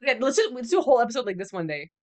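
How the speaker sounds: ordinary talk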